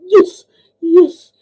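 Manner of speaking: high, strained fake whimper; mock tearful answer. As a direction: squeak it out holding back mock tears. Retold pained answer after crash.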